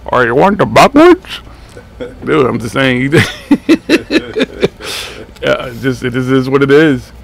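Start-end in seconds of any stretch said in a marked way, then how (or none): 0.1-1.4 high, loud, hammed-up clerk accent; high loud mock clerk voice; convenience store clerk. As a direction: tease the customer as a mock shop clerk. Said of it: "I thought I was at the Quickie Mart".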